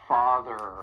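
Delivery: "Father" with a strong, held R at the end; "Father" ending in a pronounced American R; emphasized final R. Shown as a demonstration. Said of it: "really emphasize that R at the end".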